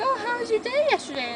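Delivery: high-pitched, breezy mock greeting; imitating a cheery friend asking about yesterday; chirpy small-talk quote. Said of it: "we would just be like".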